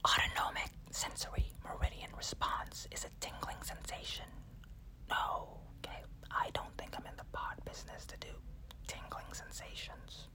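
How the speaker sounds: soft unvoiced ASMR whisper. Shown as a demonstration. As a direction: breathe it close to the mic. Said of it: "How about some A S M R?".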